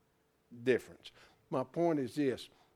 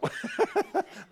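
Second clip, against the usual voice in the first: high burst of real laughter. Real, not acted. Preacher laughing at a sharp-memoried student, in a high burst of laughter.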